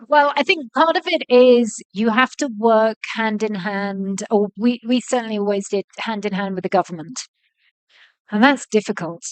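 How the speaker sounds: ordinary delivery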